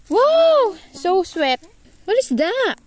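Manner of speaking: high-pitched exaggerated wows; squealed delight. Real, not acted. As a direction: gush loudly at a little one, pitch leaping up. Gushing to the little one.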